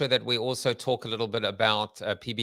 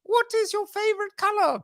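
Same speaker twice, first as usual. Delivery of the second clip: hammed-up Monty Python gatekeeper; terrible bridge keeper accent. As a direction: draw it out in a high, silly put-on voice. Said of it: "in my terrible accent".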